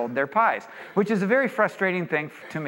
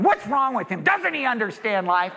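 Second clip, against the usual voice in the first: higher-pitched exasperated disbelief; retold exasperation, pitched up; frustrated; 6 semitones higher than usual. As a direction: vent mock frustration at a baffling shopkeeper. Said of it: "just so frustrated that this guy".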